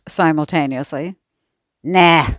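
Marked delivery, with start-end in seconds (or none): 1.8-2.3 a louder, scornful nah. A narrator's reading. Laurie dismissing his parents' guess, scornful. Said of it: "Laurie said scornfully".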